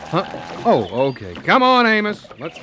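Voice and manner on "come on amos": higher, louder call. Acted. Fisherman calls to his friend in the water, calling out loudly. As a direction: shout him back to the boat.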